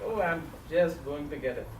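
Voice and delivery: usual speaking voice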